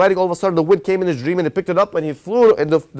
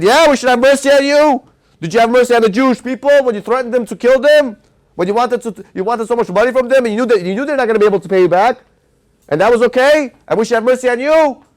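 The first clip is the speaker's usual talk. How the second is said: high, loud, scornful throwing his words back; mock him for asking mercy he never gave; angry mob; 5 semitones higher, 4 dB louder than usual. The townspeople to the cruel king, loud and scornful.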